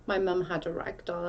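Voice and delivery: ordinary talk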